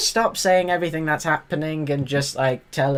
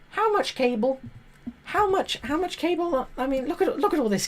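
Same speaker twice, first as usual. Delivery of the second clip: much higher, incredulous squeak; astonished; 11 semitones higher than usual. Real, not acted. Vlogger gawking at all the cable, high incredulous. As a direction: squeak out your disbelief.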